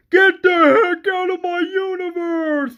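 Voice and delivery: hammed-up high-pitched shout; imitated angry yell. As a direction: yell it like a hero banishing an intruder. Acting out Monica ordering Wanda away.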